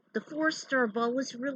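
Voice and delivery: plain reading voice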